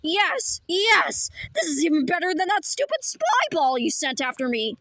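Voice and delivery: normal talking voice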